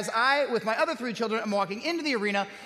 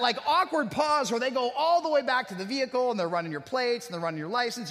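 usual speaking voice

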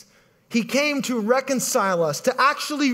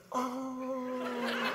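mock swoon; 14 dB quieter than usual. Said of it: "the women there like".

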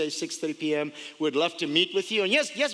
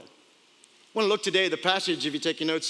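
ordinary talk, nothing marked

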